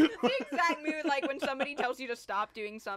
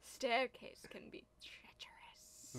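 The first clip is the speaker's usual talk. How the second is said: slow, whispered warning; drawn-out spooky whisper; eerie whisper; 13 dB quieter, slower than usual. Acted. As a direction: whisper it as an ominous film quote. Quotes the film's eerie warning.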